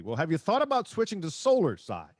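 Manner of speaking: normal talking voice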